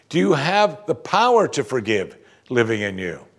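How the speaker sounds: ordinary talk, nothing marked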